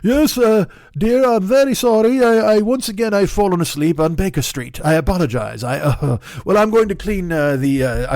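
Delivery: deliberately terrible English accent, mock-apologetic; bad English accent. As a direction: play Watson apologizing to his wife in a clumsy English accent. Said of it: "that's the worst English accent ever".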